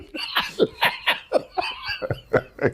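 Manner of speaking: wheezing high-pitched belly laugh; real wheezing laugh. Real, not acted. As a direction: let out a silent wheezing laugh. Friend erupts in laughter.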